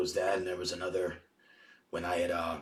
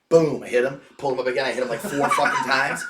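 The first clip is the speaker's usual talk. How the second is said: loud, fired-up retelling; excited; 5 semitones higher, 9 dB louder than usual. Real, not acted. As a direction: punch out each beat. Reliving beating the intruder.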